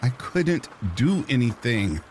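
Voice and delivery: high, desperate cry of helplessness; yelling. A narrator's reading. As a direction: protest desperately to your brother. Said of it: "I yelled at Brandon".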